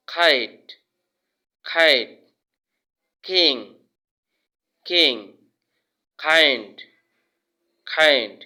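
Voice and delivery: "খাইট", "কিং", "কাইন্ড" each opening with kh; loud drill, puff of air on the k of "কিং"; aspirated K. Shown as a demonstration. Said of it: "খ এর মতো উচ্চারণ হবে".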